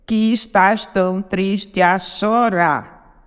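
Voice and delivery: very slow, syllable by syllable; contando sílabas. Shown as a demonstration. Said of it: "Mais sete". (escandindo devagar, sílaba por sílaba)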